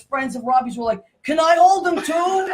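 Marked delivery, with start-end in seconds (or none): 1.2-2.5 drawn-out, higher eager plea; eager begging. Retold or imitated. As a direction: mimic an excited fan begging for a turn. Straight friends beg to hold him.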